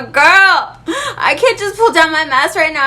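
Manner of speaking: high, loud whiny protest; hammed-up near-crying wail at the salesgirl; exasperated outburst. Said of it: "And I told her".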